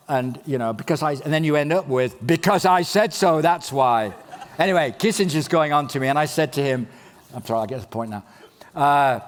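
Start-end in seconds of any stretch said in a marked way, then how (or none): 2.2-4.1 louder mock-exasperated parent; hammed-up final word of a fed-up mum. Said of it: "a mum of a five-year-old kid".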